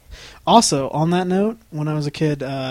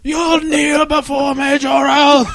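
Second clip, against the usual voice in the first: slow, higher villain command; booming demon lord; 11 semitones higher, 4 dB louder, slower than usual. Acted. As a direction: intone it like a tyrant. Quoting General Zod line.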